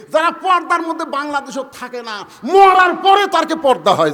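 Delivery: high, loud fervent rebuke; full-force preaching. Real, not acted. Preacher berating those who neglect purdah, high shouted sermon. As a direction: thunder it out at the congregation.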